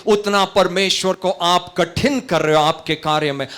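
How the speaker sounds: fast, high, loud exhortation; full-force preaching. Real, not acted. Preacher warning worriers they hinder God, full-force preaching. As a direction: hammer out each rising warning rapidly.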